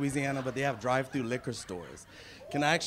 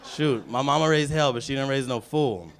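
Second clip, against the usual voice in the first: ordinary talk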